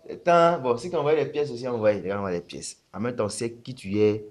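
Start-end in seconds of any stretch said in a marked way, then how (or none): none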